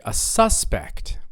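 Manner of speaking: a SUS-pect, slow and front-stressed; first-syllable stress. Shown as a demonstration. Teacher models the noun for repetition, SUS-pect. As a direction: stretch and hit the SUS in "suspect". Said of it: "first syllable".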